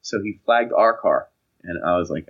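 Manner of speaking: usual speaking voice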